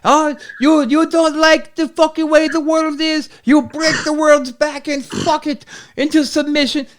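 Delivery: high-pitched imitation of a ranting wrestler; Iron Sheik rant. Sheik posting his daily Twitter rant, impression. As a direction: rant in a high mock-foreign voice. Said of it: "his daily fucking Sheik rants".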